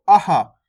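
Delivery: "अहा" as short "a" plus breathed "ha"; a followed by ha. Shown as a demonstration. Teacher models the last vowel, "अहा" in two beats. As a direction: add a clear "ha" after the a in "अहा". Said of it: "आ के बाद हा का उच्चारण".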